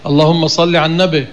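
النبي said as "in-nabe", ending in a short e; local colloquial mispronunciation. Retold or imitated. Teacher quotes how people of our country say it, colloquial ending. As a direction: run على into النبي and end it on a loose "-be". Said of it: "كما يقول بعض أهل بلادنا".

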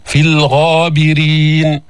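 slow chanted recitation, clear lam; ترتيل بطيء; Quran recitation. Shown as a demonstration. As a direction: chant slowly, sounding the lam. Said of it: "أتينا باللام لأنها لام قمرية".

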